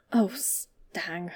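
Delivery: low, fading mumble; mumbled. A narrator's reading. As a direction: mumble it tiredly into a hand. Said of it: "he mumbled".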